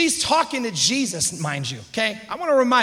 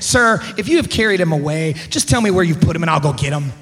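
low, loud, curt demand; too-through woman, pitch dropped; acted fed-up; 6 semitones lower, 5 dB louder than usual. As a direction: press the point, totally done with it. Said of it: "too through with this conference".

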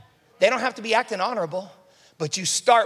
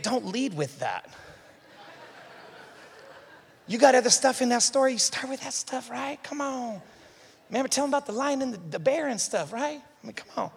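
soft, half-whispered coaching aside; quiet aside; 3 semitones higher, 7 dB quieter than usual. Retold or imitated. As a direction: murmur it like a private tip. Aside advising David.

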